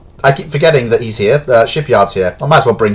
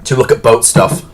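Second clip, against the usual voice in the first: usual speaking voice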